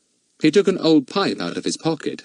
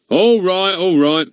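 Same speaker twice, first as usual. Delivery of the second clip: exasperated; 10 semitones higher, 6 dB louder, slower than usual. A narrator's reading. Goon giving up.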